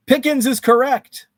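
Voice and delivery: loud, high call of the answer; excited announcement. Real, not acted. Host to viewers, revealing the card, excited. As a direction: announce the winner with a burst.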